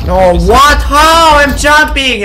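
high, loud cry of disbelief; rising frustrated yell; outraged protest. Real, not acted. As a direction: shout it as the game cheats you. Protests a death in game.

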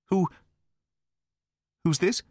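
a mild shocked stammer, breaking on the first word; halting start, lightly caught off guard; a hint of nervous stammering. A narrator's reading. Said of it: "he stammered".

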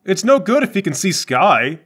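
ordinary delivery

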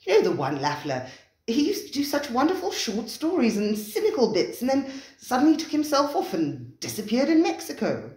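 high, excited recognition. A narrator's reading. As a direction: blurt it out as he recognises the writer. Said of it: "he said excitedly".

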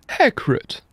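put-on Dumbledore, high old wizard voice, relieved. A narrator's reading. Dumbledore greeting the giant with relief. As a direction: breathe the name out in relief. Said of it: "mit erleichterter Stimme".

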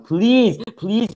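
high, loud drawn-out begging; desperate plea. Real, not acted. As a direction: beg desperately for it to end. Begging the game clock to finish.

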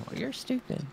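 high, soft put-on mocking voice; silly high-voiced insult; mocking critic. Imitating name-calling online critics.

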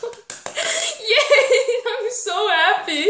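high giggly yay; laughing cheer. Real, not acted. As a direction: laugh it out happily. Laughing with joy.

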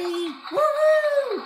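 high, loud woohoo cheer; celebratory cheer. Real, not acted. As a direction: whoop it up for the winner. Celebrating winner.

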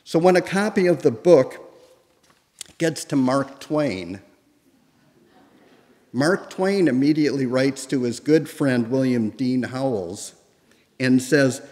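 ordinary talk

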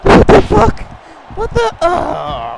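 very high, startled yelp; play-acted shock, loud then falling away; shocked outcry. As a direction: cry out as if suddenly hit. Shocked at being hit in play.

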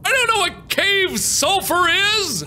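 high, slow bewildered wail; wailing in confusion; frantic exasperation. Real, not acted.

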